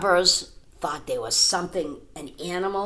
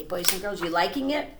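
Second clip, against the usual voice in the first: plain reading voice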